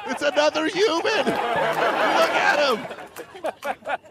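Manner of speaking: put-on high, astonished old lady voice; amazed lady. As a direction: gasp in wonder like a sheltered lady. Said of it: "She's like".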